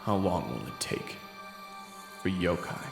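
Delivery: plain reading voice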